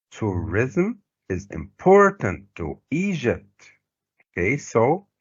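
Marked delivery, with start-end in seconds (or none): 0.1-3.7 IMPORTANT stressed on the middle syllable; marked word stress. Shown as a demonstration. Teacher repeating the sentence for students, marked word stress. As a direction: model reading with TOURISM, IMPORTANT and EGYPT each stressed. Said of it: "the stress will be like this".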